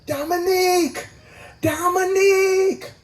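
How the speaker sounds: high, slow calling out of a name; high drawn-out call; stripper calling out. Retold or imitated. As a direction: call the bouncer's name from across the room. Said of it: "one of the strippers go".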